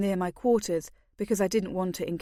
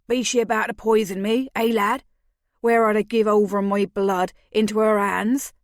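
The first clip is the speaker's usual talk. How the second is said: louder croaky North Country voice; mock-fearful North Country accent; 6 dB louder than usual. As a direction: keep the North Country yokel croak going. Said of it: "in a perfect North Country accent".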